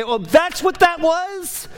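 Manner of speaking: high, slow dawning surprise; high-pitched mock surprise at the penny dropping. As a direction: act out a sudden realisation. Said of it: "And you might say".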